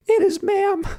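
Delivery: mock falsetto, very high; exaggerated high-pitched put-on voice. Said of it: "do a high-pitched voice".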